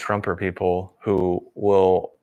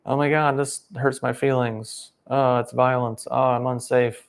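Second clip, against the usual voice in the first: mock infant whine; 5 semitones higher than usual. Mocking the fragile activists as infants, in a high whiny voice. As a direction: whimper it like a wounded toddler. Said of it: "they just default to infant".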